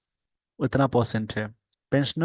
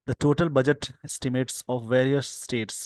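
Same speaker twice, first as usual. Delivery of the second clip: slow dictation; much slower than usual. Shown as a demonstration. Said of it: "slow dictation reading".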